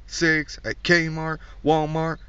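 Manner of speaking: ordinary talk, nothing marked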